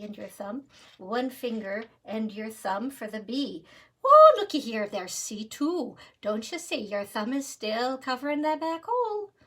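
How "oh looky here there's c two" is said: hammed-up Irish delight; mock Irish exclamation. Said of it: "let's switch to Irish".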